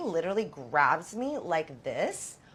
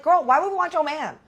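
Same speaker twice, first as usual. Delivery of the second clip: put-on higher, louder, scoffing girl; incredulous loud retort; 6 semitones higher, 6 dB louder than usual. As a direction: throw it back at her, louder and up high. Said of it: "I'm like".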